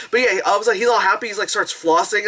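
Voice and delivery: normal talking voice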